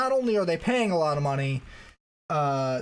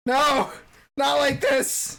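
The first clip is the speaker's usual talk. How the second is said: dramatic wail, slowing on the last words; mock despair; 8 semitones higher, 4 dB louder, much slower than usual. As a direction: ham up the heartbreak. Mock despair over team losing.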